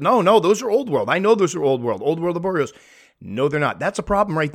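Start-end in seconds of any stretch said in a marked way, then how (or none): 0.0-2.7 higher, louder mock protest; confused insistence. Mistaken breeder arguing over old worlds, mock insistent. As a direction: imitate someone stubbornly arguing a mistake. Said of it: "and then were confused".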